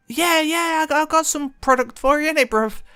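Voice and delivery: very high, over-keen imitation; hammed-up high-pitched bloke; eager friend. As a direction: pipe up as the all-too-helpful mate. Imitates friend's eager reply to stranger.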